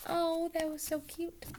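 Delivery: high, soft gush; delighted aside. Real, not acted. Teacher reacting to the story's end, softly gushing. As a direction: coo over the happy ending. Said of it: "reacting after the story".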